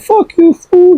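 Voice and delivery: a high, loud mock yell; mock angry outburst. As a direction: snap back like you've been insulted. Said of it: "you might get mad and be like".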